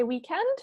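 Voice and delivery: weekend with the stress on END; stress on second syllable. Shown as a demonstration. Teacher explaining stress to a student, weekend stressed on END. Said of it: "the stress would be on the second syllable".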